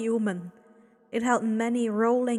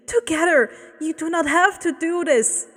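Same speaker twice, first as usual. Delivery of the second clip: higher, raised plea; pleading; 6 semitones higher, 7 dB louder than usual. Acted. Woman pleads with Abel, higher and raised. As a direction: coax him to stop. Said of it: "she pleaded".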